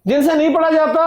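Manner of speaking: normal talking voice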